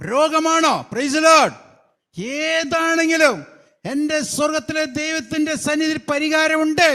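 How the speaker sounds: loud, high, rising preacher's cry; preaching at full force. Acted. Preacher crying out to the congregation, loud and high. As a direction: build it to a shouting climax of faith.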